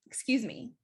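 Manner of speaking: light, courteous "excuse me"; polite tone. Shown as a demonstration. Coach showing students the better tone, polite. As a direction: say it pleasantly, with no attitude. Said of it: "very different than me saying".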